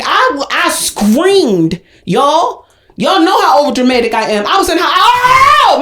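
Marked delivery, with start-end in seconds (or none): 0.0-1.8 "screamed" stretched very slowly; drawn-out SCREAMED. Real, not acted. Stressing how much she screamed. 4.9-5.8 high, loud "oh" scream; hammed-up overdramatic wail; re-enacted scream.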